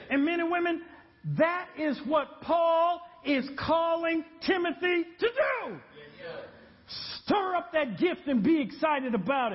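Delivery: full-force preaching. Acted. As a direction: build it up and shout it to the congregation.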